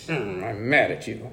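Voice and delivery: low, slow mock grumble; imitated toddler anger. Dad imitating his three-year-old mad at siblings, low slow grumble. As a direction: grumble it slowly like a cross little kid. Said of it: "when he gets mad".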